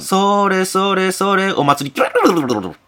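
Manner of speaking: high, drawn-out festival chant with trilled noise; stretched-out mock festival call; imitated festival DJ. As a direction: mimic a DJ track, slow chant into a rolled trill. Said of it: "ディージェーだ、ディージェー".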